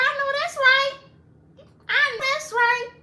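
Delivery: high, loud sassy cheer; hyped approval. Retold or imitated. Cheering the widow on about her many matches, loud and high. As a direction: cheer her on, all attitude. Cheering friend's dating success.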